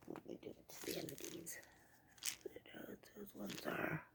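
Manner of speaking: tizanidines whispered under the breath; whispering. Real, not acted. Reading pill name under breath.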